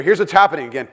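usual speaking voice

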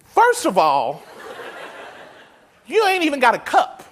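sassy retort. Retold or imitated. Voicing the woman's sassy retort.

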